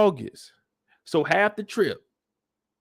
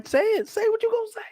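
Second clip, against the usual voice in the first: much higher, daring voice; taunting father; faster than usual. Retold or imitated. Father daring his son to talk, high taunting. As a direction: goad him to speak, hammed up. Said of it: "He's like".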